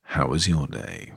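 low, soft sincere. Shown as a demonstration. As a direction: ask gently and slowly, with care. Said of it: "I'm gonna ask again".

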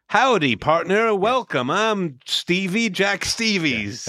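slow, high-pitched cowboy guide drawl; hammed-up Wild West museum host; cowboy reenactor. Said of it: "a person who's going".

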